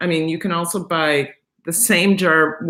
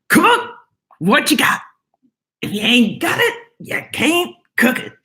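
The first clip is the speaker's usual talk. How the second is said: higher, sing-song mock TV cook; put-on catchphrase voice; 5 semitones higher than usual. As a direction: ham up a cooking-show catchphrase, higher. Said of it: "okay, don't laugh at me".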